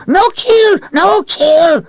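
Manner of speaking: high, loud frantic begging; pleading creature. A narrator's reading. Creature screeching at Richard not to kill it. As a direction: beg shrilly for your life.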